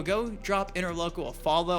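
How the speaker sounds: ordinary talk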